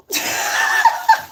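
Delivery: high-pitched burst of laughter; real, squealing giggle. Real, not acted. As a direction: crack up at your own joke. Laughs at own trolley joke.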